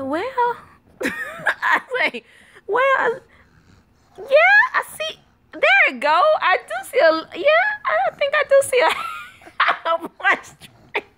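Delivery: high, squeaky mock appraisal; laughing. Mom inspects son's underarm hair, laughing. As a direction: struggle to talk through giggles.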